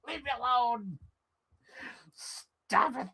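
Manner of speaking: high, soft, whining to be left alone; mock whiny plea. The user begging the algorithm to stop, high whine. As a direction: beg off in a high pleading whine. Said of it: "It's like".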